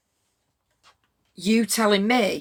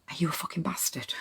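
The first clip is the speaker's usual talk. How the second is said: a softer, hushed whisper; 8 dB quieter than usual. Retold or imitated. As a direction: whisper it like a secret. Retelling David's quiet question.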